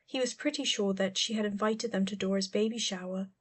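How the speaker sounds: plain reading voice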